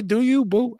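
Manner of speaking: high mock wife voice, rising at the end; a husband mimicking his wife's send-off; imitating wife. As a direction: toss it off like the wife, light and teasing. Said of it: "She say".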